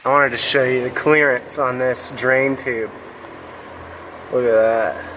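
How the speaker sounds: talking while lying on back; strained voice from under a car. Real, not acted. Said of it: "so I'm under the car".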